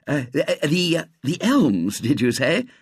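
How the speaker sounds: stumbling, eager question; eagerly. A narrator's reading. Robert eagerly asks the pirate about the Elms. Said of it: "said Robert eagerly".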